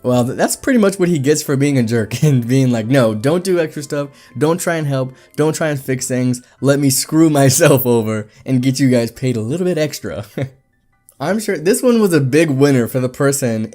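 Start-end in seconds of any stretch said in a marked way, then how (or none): none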